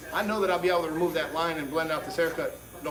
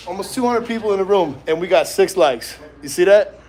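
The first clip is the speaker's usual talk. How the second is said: loud, exasperated outburst; shouting over wind; 10 dB louder than usual. Real, not acted. Complaining to chat about likes.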